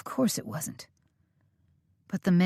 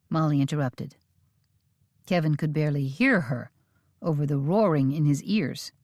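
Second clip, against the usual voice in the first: ordinary delivery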